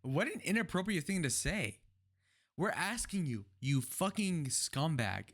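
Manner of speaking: outraged. Real, not acted. Outraged reaction to Walz answer.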